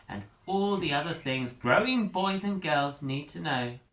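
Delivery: lightly voiced prim teacher. Teacher Thurley speaks.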